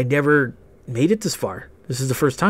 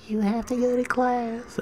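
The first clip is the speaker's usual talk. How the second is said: soft, high little girl imitation; tiny squeaky voice; 10 semitones higher, 7 dB quieter than usual. Imitated classmate fetching boy from gym, tiny squeaky voice. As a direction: squeak it out small and quiet. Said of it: "with the tiny squeaky voice".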